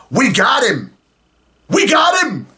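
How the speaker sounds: lightly voiced gleeful governor, rising on the second; mock governor. Host imitating the governor bragging at the press conference, mildly mocking. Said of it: "the governor".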